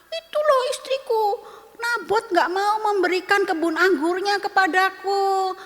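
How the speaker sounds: petulant royal whine to his queen; whiny sulking king. Acted. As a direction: play the pouting king begging for sympathy. Sulking king complains to wife.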